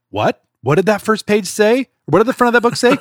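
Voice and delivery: lightly voiced worried puppet, in disbelief; Grover. Grover reacting to the book's first page, mildly alarmed. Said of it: "Grover is like".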